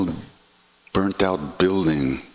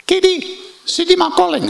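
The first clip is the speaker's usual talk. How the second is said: much higher, louder call; sing-song summons from across the temple; a woman calling him to come see Siddhi Ma; 7 dB louder than usual. Retold or imitated. Lady summoning him from afar.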